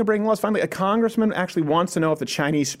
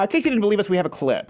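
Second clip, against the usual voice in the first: ordinary talk, nothing marked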